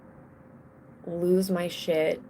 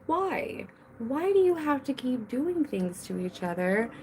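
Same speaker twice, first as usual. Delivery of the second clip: high-pitched exasperated plea, acted out; exasperated parent; 5 semitones higher than usual. Mother retelling pleading with bickering children, exasperated parent. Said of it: "And I'm like".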